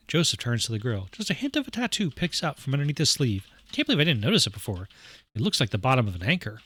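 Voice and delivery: ordinary talk, nothing marked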